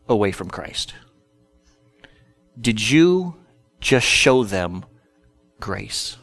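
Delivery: slow, hushed questioning with long pauses; gently probe, letting each phrase land softly; Holy Spirit. Acted. Holy Spirit convicting the preacher after his outburst, slow and soft. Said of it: "And the Holy Spirit said".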